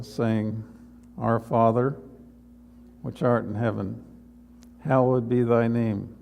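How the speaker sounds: low, measured prayer to the Lord; a pastor praying before the congregation, reverent. Real, not acted. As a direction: pray it reverently, closing the sermon. Pastor leads closing prayer.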